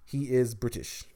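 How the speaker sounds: is pressed with a falling tune in He is British; He is British. with is emphasized; stress on is. Shown as a demonstration. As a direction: lean on is to insist. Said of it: "I wanna make sure I get that out there".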